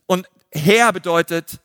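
ordinary talk, nothing marked

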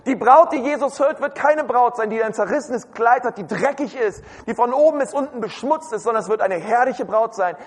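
ordinary talk, nothing marked

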